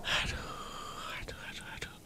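breathless unvoiced whisper. Real, not acted. Hiding from the enemy, tense whisper. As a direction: mouth it without voice.

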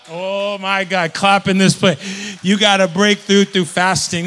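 loud, slow call to clap; preacher whipping up the church; fiery preaching. Acted. Preacher rousing the congregation, loud and slow.

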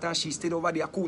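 ordinary talk

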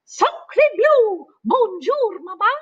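a duckling greeting Jack as mama; baby duck. Acted. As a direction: squeak it like a newborn. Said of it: "looked up at Jack and said".

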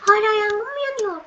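mock alarm at the smoke; startled. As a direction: cry out as the fire flares up. Smoke everywhere, fire again.

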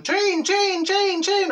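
put-on high excited toddler chant. Toddler chanting at the trains, high and loud. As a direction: squeal it like a wee girl seeing trains. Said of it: "And she's going".

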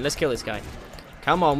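ordinary talk

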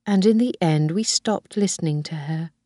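ordinary delivery

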